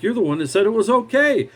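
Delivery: high-pitched, indignant retort; acting out a fed-up comeback; retold yelling back. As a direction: throw the blame back, pitch jumping up. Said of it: "I finally yelled back".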